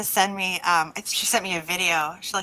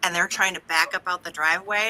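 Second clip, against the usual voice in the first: ordinary delivery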